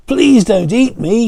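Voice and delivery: high, loud pleading plea; begging. A narrator's reading. John begs the troll for his life, high pleading. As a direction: beg the troll not to eat him. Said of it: "he begged".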